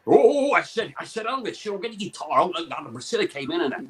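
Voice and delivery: lilting Welsh accent, showbiz raconteur; put-on Welsh celebrity accent. Shown as a demonstration. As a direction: reminisce in a sing-song Welsh accent. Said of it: "you got a bit of [name] in you?".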